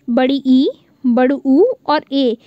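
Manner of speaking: ई and ऊ stretched, slow; long vowels. Shown as a demonstration. Teacher lists long vowels, stretching each. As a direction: draw out ई and ऊ slowly. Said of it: "तो बड़ा आ".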